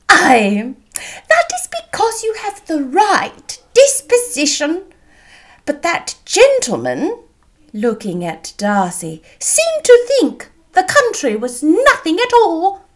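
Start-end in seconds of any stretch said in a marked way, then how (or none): 0.1-7.2 high, emphatic matronly voice; indignant mother. A narrator's reading. Voices Mrs Bennet, indignant at Darcy. 9.4-12.8 high, emphatic matronly voice; indignant mother. A narrator's reading. Voices Mrs Bennet, indignant at Darcy.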